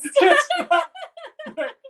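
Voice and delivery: very high, loud mocking squeal; mock squeak. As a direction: squeal it like a parody. Mocking her protest.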